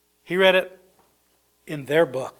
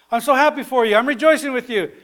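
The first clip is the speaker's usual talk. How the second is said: put-on joyful older brother; 7 semitones higher, 3 dB louder, faster than usual. Older brother voiced rejoicing.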